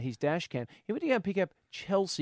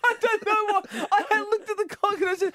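very high voice breaking into laughter; helpless laughter; 3 dB louder than usual. Real, not acted. Host explaining the badly timed story, helplessly laughing. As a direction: struggle to talk while cracking up.

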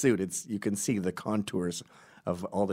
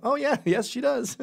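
mock guys agreeing; 5 dB louder than usual. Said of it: "and they go".

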